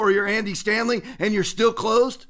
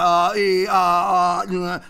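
mocking stumbling; 2 semitones lower, 3 dB louder, much slower than usual. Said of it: "that's how he sounded".

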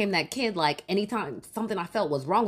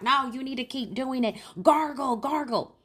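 put-on raised, pushy old-lady voice; insistent grandma; 7 semitones higher than usual. Grandma urges the crying child to keep gargling, insistent and high. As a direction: press the kid to keep going.

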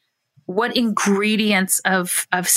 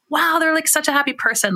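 mock gushing wow; 8 semitones higher than usual. Imagined listener hearing happy voice.

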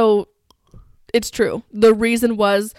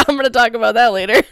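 fast; 3 dB louder, faster than usual. Retold or imitated. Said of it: "And then I'm like".

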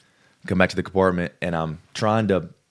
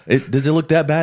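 panicked question; 9 semitones higher, 6 dB louder than usual. Retold or imitated. Panicking recruit asks about knee.

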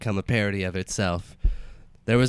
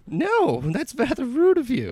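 high-pitched dead mother voice; 13 semitones higher, slower than usual. Acted. Dead mother character speaking.

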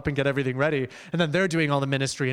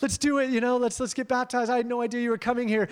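higher-pitched, gushing retelling of an excited student; imitating excited freak-out; 8 semitones higher than usual. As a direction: act out her giddy freak-out in a raised pitch. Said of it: "she starts freaking out".

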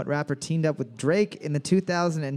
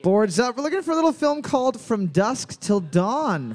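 announcer voice; 5 semitones higher, 4 dB louder than usual. Acted. Host calls boards and answer.

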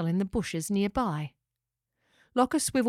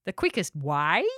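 swooping pelican voice, swelling louder; incredulous cry; 6 dB louder than usual. A narrator's reading. Gary scoffs at a kangaroo wanting a lift, incredulous cry. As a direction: scoff in disbelief, stretching key words. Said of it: "cried out Gary the pelican".

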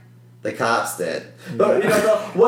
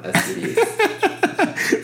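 squeaky high laugh through the word; laughing sarcasm; much slower than usual. Real, not acted.

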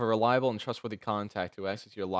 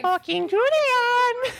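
put-on very high slow kid voice; squeaky child voice; 7 dB louder, much slower than usual. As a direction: squeak it slowly like a brat.

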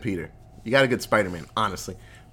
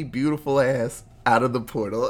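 normal talking voice

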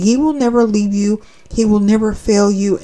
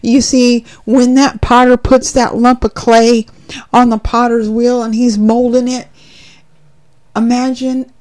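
ordinary delivery

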